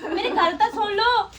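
high, loud indignant yelling; stammering fury, shrill and loud; outraged shout. Acted. Wife to husband, furious he gave away her necklace, shouting.

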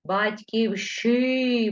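Батьківщи drawn out syllable by syllable; slow dictation. Shown as a demonstration. Teacher writing the word for pupils, slow dictation. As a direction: spell out Батьківщи slowly as you write it. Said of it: "буква И після щ".

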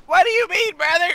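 very high-pitched incredulous streamer voice; Daquan impression. Gamer challenging his buddy in a high Daquan impression. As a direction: pitch it way up, indignant. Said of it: "Oh, that sounds like Myth".

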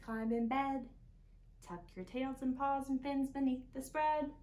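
ordinary delivery

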